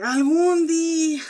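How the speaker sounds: loud, slow ¡Algún día! with the weight on algún; emphatic exclaim. Real, not acted. Dramatic vow to viewers about someday trying.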